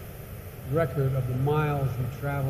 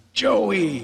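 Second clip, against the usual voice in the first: imitating the conductor; 6 semitones higher, 6 dB louder than usual. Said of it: "grabbed me and said".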